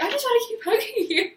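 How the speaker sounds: giggly and lovestruck; giddy laugh. Retold or imitated. Retelling her giddy words.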